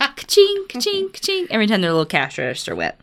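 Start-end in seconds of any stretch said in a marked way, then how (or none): 0.0-0.6 ka-ching. Pretend. Imitating cash register. 0.7-1.5 ka-ching. Pretend. Imitating cash register.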